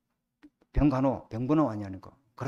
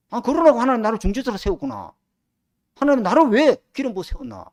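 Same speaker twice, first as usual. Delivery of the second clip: thundering rebuke; 3 semitones higher, 6 dB louder than usual. Retold or imitated. Preacher rebuking his church elders, loud and scolding. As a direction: raise the voice, loud and pitched up, scolding. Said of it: "내가 막 되게 호통을 쳐보거든".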